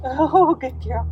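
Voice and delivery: delighted burst of praise for the dog; excited praise. Real, not acted. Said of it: "use my voice to praise her".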